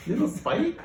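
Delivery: high squeaky surprised question; high delighted little squeak; surprised coo. Acted. Cynthia's cooing question.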